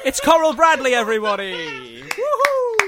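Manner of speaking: loud, high showman's announcement; excited announcement. Acted. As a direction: hype up the guest's entrance. Announcing star guest.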